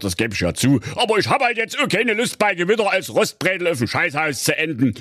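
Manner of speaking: hohe, aufgebrachte Königsstimme; wütender König. Acted. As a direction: schimpfender Märchenkönig, der seine Tochter zurechtweist. König rechtfertigt sich. (der König schimpft empört mit seiner Tochter)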